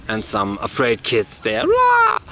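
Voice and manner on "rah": loud, very high wail; mock child's crying. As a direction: imitate afraid kids bawling in one burst. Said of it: "like, crying".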